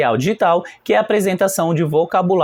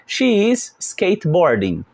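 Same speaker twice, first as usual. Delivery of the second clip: slow model answer; slower than usual. Shown as a demonstration. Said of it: "Então a resposta".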